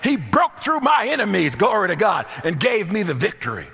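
high, loud, fervent preacher's cry; full-force preaching. Acted. Preacher to congregation about David's breakthrough, full-force preaching. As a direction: preach it at the top of your voice.

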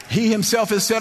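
normal talking voice

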